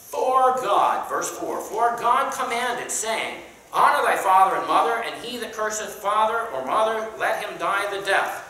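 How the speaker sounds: forceful King James recitation; scripture reading. Acted. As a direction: proclaim the commandment to the room. Reads scripture aloud to class.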